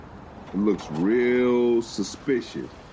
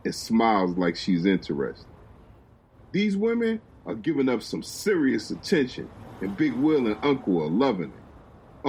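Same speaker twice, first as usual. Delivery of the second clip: usual speaking voice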